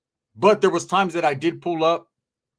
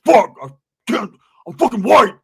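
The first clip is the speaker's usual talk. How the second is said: 1 mock angry rant; 4 semitones higher, 9 dB louder than usual. Angry friend ranting.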